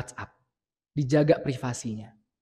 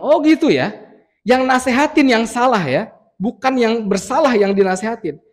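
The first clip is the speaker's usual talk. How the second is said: mocking, indignant comeback, higher and louder; sarcastic retort; 8 semitones higher, 8 dB louder than usual. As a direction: throw the advice back at him sarcastically. Anggota membantah dengan sinis. (balasan sinis yang tersinggung)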